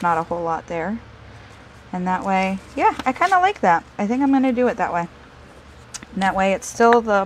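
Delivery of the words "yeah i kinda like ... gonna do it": suddenly bright and high, pleased; light up with delight. Real, not acted. Pleased with her craft choice.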